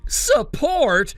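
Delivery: high incredulous sneer; scoffing. Acted. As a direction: throw the word back, mocking. Brother scoffs at sister.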